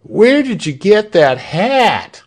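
high jeering cry; crowd cry. A narrator's reading. Crowd jeering at the guards' hat, high and loud. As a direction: jeer at the guards. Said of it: "Cries of".

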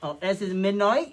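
high, loud mock-British introduction; attempted British accent. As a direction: announce the cat in over-the-top British. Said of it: "that accent you're doing".

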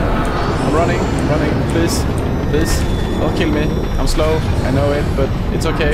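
running for your life, pleading; scared horror game streamer. Real, not acted. Fleeing monster in horror game.

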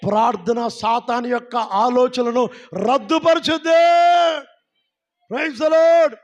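loud slow proclamation then praise cry; fervent preaching shout. Real, not acted. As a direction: proclaim it over the church. Preacher declares against Satan.

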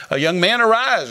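rising-pitched retold command; commanding call. Jesus to the widow's son, commanding call. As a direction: call the dead to get up. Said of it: "Jesus cried with a loud voice".

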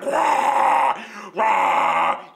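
loud, sustained mock waterfall roar; roaring waterfall imitation. Comic imitating noisy stall neighbour, waterfall roar. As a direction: roar like crashing water, two long bursts. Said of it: "it sounded like the waterfalls".

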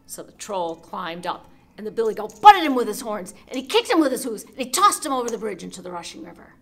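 loud, building climax; excited narration. A narrator's reading. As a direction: tell the fight with rising drama.

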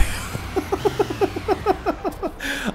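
high squealing laugh. Real, not acted. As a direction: burst out laughing. After running someone over.

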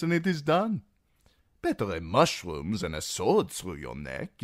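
a sly host chuckling over his guest's fears; voice of Illyrio. A narrator's reading. Magister mocking Tyrion's refusal to eat, sly amusement.

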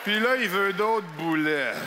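mocking cashier. Cashier sneering at the fat customer, slow and low. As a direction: drawl it like a scornful server. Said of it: "elle fait".

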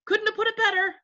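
high-pitched yes-man chorus; eager agreement. Acted. Others echo the chief, eager high agreement.

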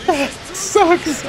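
high, cracking whine of pain; anguished whine. Real, not acted. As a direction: let it out like a wounded moan. Groaning over a failed play.

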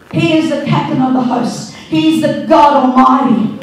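loud, declaiming praise; preaching at full force. Acted. Pastor exalting God before the church, loud preaching. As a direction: proclaim every word to the back row.

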